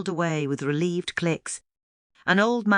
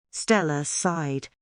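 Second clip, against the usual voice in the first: usual speaking voice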